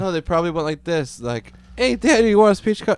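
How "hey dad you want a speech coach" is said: higher, louder chirpy offer; hammed-up helper voice; high mock offer. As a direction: pitch it up like an eager helper. Imitating speech coach offer.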